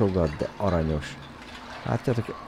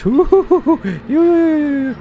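very high, loud panicked whooping; cartoon squeals; 12 dB louder than usual. Gamer's character squealing while running away.

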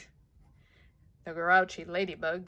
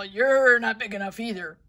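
grouchy loud retort; 3 semitones higher, 8 dB louder than usual. Acted. Grouchy ladybug dismisses lobster.

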